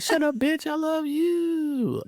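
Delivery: put-on very high drunken squeal of affection; mock-drunk gushing with a long, drawn-out final word; drunk woman. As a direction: imitate a tipsy friend squealing her love. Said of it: "acting drunk".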